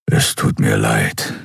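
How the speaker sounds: slow, deep grumbled apology; gruff, low-pitched murmur; low rumble. A narrator's reading. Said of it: "brummte er".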